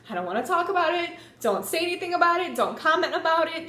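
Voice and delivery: high, fast defensive retort; defensive brush-off. Retold or imitated. Retold dodging of mom's question.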